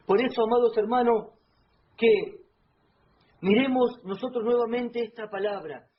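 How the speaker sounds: loud, fervent preacher exhortation; predicación fervorosa a todo volumen; preaching at full force. Acted. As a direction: call the congregation to look again, loudly.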